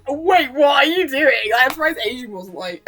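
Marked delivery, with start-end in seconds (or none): 0.1-1.3 loud, higher retold disbelief; mock-shocked question; baffled protest. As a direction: demand an explanation, amused and baffled. Retelling own bafflement at accent switch.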